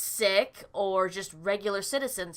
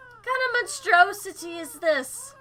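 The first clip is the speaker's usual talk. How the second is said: higher outraged disgust; horrified cry; 9 semitones higher, 3 dB louder than usual. Acted. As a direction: recoil in horror at the monster. Character reacts in horror.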